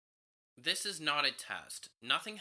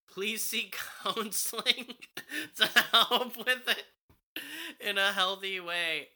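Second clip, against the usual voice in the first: high laughing voice; 10 semitones higher, 7 dB louder than usual. Real, not acted. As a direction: laugh through the words. Laughing at the advice.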